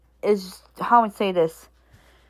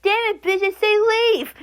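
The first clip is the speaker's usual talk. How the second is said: much higher, squeaky meme impression; 11 semitones higher than usual. Quoting a meme to a screaming girl, in a much higher squeaky voice. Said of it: "I'm trying to do this, like, the meme".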